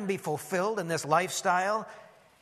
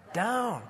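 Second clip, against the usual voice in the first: "Down" pitched up, then falling; emphasis on "Down"; 5 semitones higher than usual. Shown as a demonstration. Preacher leading listeners to say it, emphatic "Down". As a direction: repeat "Down" as the key word. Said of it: "I'll emphasize the key words".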